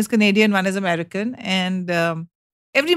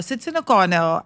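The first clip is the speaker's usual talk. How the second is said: ordinary delivery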